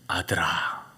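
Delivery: low, soft voice calling from behind; low ghostly call. Retold or imitated. An unseen voice calls the son, low hush.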